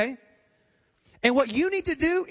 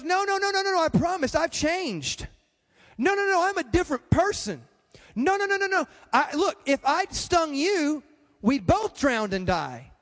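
pleading scorpion; 5 semitones higher than usual. Retold or imitated. Scorpion begging the frog to trust him, higher frantic pleading. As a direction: beg hard, swear you've changed.

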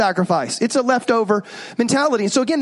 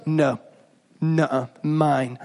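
put-on lower, slow pouting little-girl no; stubborn child; 8 semitones lower, 3 dB quieter, much slower than usual. Daughter refusing to share her candy, pouting. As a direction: pout like a toddler guarding candy.

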